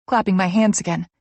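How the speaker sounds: ordinary delivery, nothing marked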